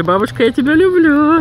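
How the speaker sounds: sing-song prompt. Shown as a demonstration. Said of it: "Скажи".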